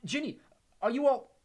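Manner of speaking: high alarmed cry. Acted. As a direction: call out in alarm. Harry alarmed.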